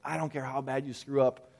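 ordinary talk, nothing marked

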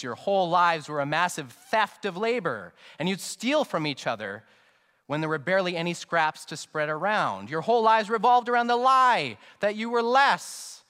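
loud, high-pitched sermon delivery; impassioned preaching. Real, not acted. Preaches at raised intensity.

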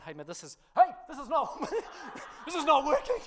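high, laughing panic on a loose ride harness; panicked laughing yelp. Retold or imitated. Pastor retelling the ride to the congregation, laughing panic. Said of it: "and I'm, I'm going".

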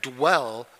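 "dwell" drawn out as one syllable; mimicked correction, "dwell" as one beat. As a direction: stretch "dwell" without splitting the D from the W. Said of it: "It's not "da well".

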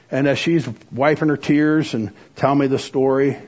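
ordinary delivery

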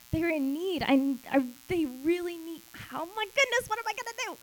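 rising overwhelmed "oh my goodness" panic of caring; eager concern. Retold or imitated. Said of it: "No, he said".

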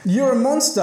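high-pitched dramatic accusation; acted, high outburst; crying out. A narrator's reading. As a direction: read it as a startled cry. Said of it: "I cried again".

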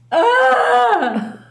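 high, loud mock wail. Newcomer wailing at the old-timers' sobriety, high and loud. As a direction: let out a big high comic wail.

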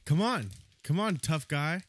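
put-on cocky kid daring a fight; taunting tough guy. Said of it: "and he said".

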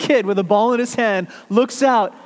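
ordinary delivery

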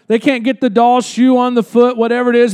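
ordinary delivery

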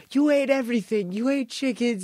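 high, taunting impersonation; mock accusation. Wrestler taunts the boss on stage, high mock accusing voice. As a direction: imitate someone needling the boss.

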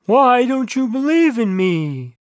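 slow, drawn-out divine question; booming God voice. A narrator's reading. God questioning a doubter, slow booming voice. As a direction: stretch it out like God from the sky. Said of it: "asked a booming voice".

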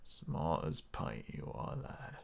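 very quiet, slow, low growl; low unvoiced rasp, drawn out; gritty voice. Shown as a demonstration. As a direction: try a whispered gritty pirate. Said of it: "What else do I have with grit?".

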